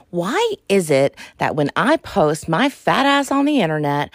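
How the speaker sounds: normal talking voice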